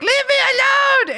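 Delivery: high, loud shrieking "leave me alone"; mock cat yell. Cat voiced demanding to be left.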